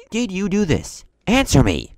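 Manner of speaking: loud, stern demand. Retold or imitated. Instructor demanding a confession, loud and stern. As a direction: bark the question at them.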